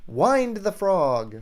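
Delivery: slow, playful celebrity imitation; Tom Hanks. Shown as a demonstration. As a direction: stretch it slowly like a hammy impression. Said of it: "doing your best Tom Hanks impression".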